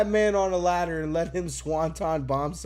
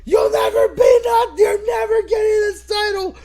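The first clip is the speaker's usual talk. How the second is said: bellowed high and loud, full force; furious shout; 14 dB louder than usual. Retold or imitated. Podcaster imitating Gunther yelling at Jey Uso, furious shout. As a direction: scream it down at a beaten opponent. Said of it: "he just fucking yells".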